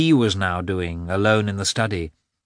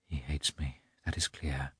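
much softer, private realization; inner thought; 6 semitones lower, 13 dB quieter than usual. A narrator's reading. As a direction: murmur it to herself, as a thought. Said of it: "thought she".

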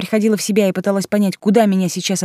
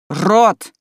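louder, higher cry; sharp exclamation; 4 semitones higher, 6 dB louder than usual. A narrator's reading. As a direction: blurt it out sharply. Heroine's exclamation.

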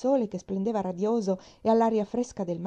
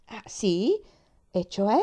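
brusque; 2 semitones higher, 4 dB louder, much slower than usual. A narrator's reading. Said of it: "disse mantenendo il tono brusco".

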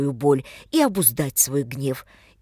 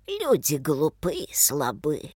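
put-on slow, creaky voice of an old leper; creaking old man; slower than usual. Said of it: "проскрипел старик прокажённый".

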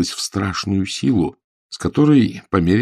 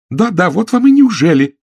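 angry retort; 13 semitones higher, 8 dB louder than usual. A narrator's reading. Quarrel retort.

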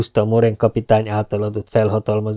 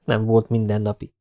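plain reading voice

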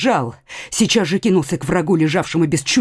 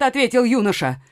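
usual speaking voice

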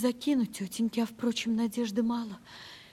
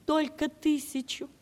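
ordinary delivery

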